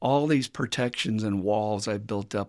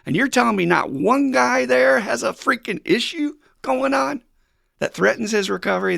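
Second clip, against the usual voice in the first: much higher and louder incredulous challenge; indignant disbelief; 12 semitones higher, 7 dB louder than usual. Real, not acted. Speaker calling out a silent roomful of guys, indignant. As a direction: demand it like you can't believe it. Indignant rant about meeting honesty.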